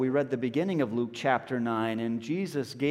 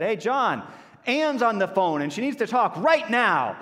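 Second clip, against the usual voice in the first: raised call through a door, higher and louder; 6 semitones higher, 4 dB louder than usual. Retold or imitated. Wife calling through the bathroom door, raised voice. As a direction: call out to him in the bath. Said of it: "pounded on the door and said".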